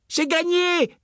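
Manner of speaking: put-on very high triumphant child shout; girl yelling. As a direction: crow victory like a little girl. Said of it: "hurla sa fille".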